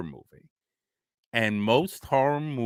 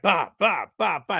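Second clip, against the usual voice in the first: mock gun sounds; 8 semitones higher, 3 dB louder than usual. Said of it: "as the doctor was like".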